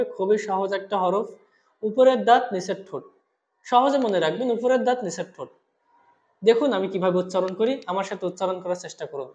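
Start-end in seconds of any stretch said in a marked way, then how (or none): none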